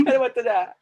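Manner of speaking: high, hammed-up wail of kids begging him to stay; mock crying children. Teacher voicing Pradeep's kids begging him not to go, mock wail. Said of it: "वो लोग रो रहे हैं।".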